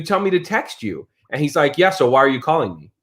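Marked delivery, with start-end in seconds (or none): none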